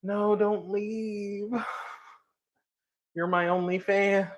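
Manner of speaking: slow, whiny plea; mock pleading. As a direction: beg them playfully to stay. Pleading with chat not to leave.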